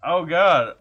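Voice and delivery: normal talking voice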